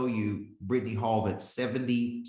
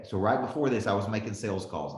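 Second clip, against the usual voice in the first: ordinary talk, nothing marked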